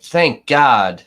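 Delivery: loud, relieved thank God; jury shouting out in relief, a loud mock cry. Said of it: "And the jury was like".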